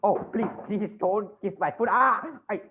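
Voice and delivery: high, pleading protest; flustered recoil. Acted. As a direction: beg her off in alarm. Banker recoils as petitioner kisses foot.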